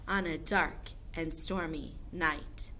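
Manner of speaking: ordinary talk, nothing marked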